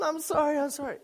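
high, whimpering child imitation; mock tearful apology. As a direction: plead like a little kid in trouble. Crying child apologises to his dad.

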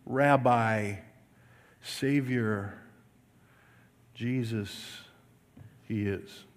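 normal talking voice

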